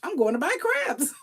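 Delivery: squealing at the bank balance; mock giddy splurge. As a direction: gush over the windfall. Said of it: "You're like".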